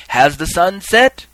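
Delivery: slow, high, loud question; crying out. Acted. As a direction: call it out ceremonially. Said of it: "He would cry, cry out again".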